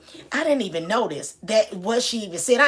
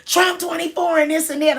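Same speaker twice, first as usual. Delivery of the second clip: mock holler; 8 semitones higher, 4 dB louder than usual. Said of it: "this lady just hollered out".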